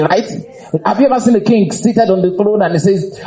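normal talking voice

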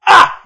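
high, loud mocking shriek "Ah!". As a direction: shriek in scornful mockery. Mocking careless people, shrieks.

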